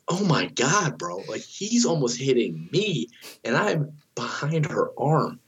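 high, drawn-out exclamation with big pitch swoops; alarmed disbelief. Retold or imitated. Dad behind her arm watching the doctor yank, alarmed. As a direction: stretch the words out high in mock horror.

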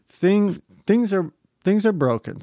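imitating the mechanic breaking bad news; mock mechanic. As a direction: stammer it out like a hesitant mechanic. Voicing the mechanic.

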